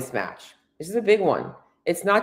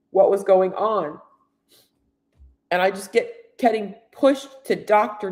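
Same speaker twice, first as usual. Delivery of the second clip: emotional; 3 semitones higher than usual. Real, not acted. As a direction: let the hurt push the words out. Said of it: "I'm sorry that I'm emotional".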